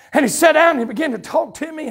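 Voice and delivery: ordinary talk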